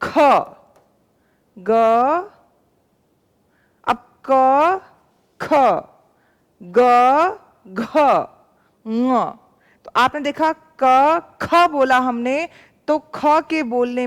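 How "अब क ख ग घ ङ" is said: क, ख, ग, घ, ङ with long pauses and a clear puff of air on ख and घ; consonants demonstrated slowly. Shown as a demonstration. Teacher slowly demonstrating aspiration to pupils, letter by letter. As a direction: slowly spell out क ख ग घ ङ, pushing extra air on ख and घ. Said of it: "देखिए जब मैं बोल रही हूं क".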